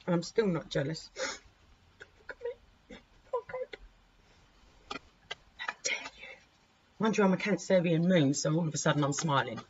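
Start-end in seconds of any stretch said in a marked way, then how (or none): 2.0-6.4 mock-offended whisper.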